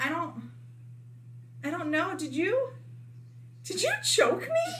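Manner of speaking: confused. Retold or imitated. Confused after blacking out.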